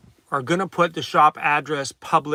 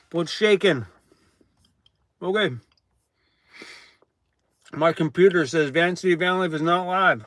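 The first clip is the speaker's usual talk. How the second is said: ordinary delivery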